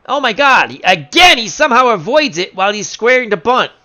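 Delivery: loud, high, incredulous call; exasperated disbelief. Pretend. Announcer watches Pennock dodge the pitch, loudly incredulous. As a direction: cry out at the failed plan.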